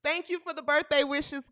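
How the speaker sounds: usual speaking voice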